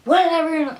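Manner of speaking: high, loud dismissive mock retort; rude tone. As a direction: brush them off rudely. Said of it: "in, like, a rude tone".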